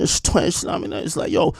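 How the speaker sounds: slurred mumbling imitation, words running together; mush-mouthed. Mocking the rapper explaining himself, mush-mouthed. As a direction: garble it into soft mush. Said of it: "randomly throw a twin in there".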